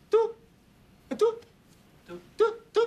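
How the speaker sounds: very high card-reader beeps; imitated beep. Shown as a demonstration. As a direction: squeak out short, bold beeps. (高音模仿拍卡嘟聲)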